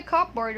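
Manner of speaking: "cupboard" read as cup plus board; "cupboard" with a full "board" at the end; spelling pronunciation. Shown as a demonstration. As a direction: sound the p in cupboard. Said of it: "There's a cup and there's a board".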